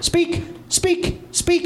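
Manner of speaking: high breathy coaxing dog command. Retold or imitated. Owner coaxing his dog Sam, high and breathy. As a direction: coax the dog to bark for a treat. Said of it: "This is what I do to Sam".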